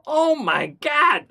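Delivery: high, loud, slow alarm; hammed-up horrified cry; acted freaking out. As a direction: stretch it out in mock panic. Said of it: "I'd be freaking out".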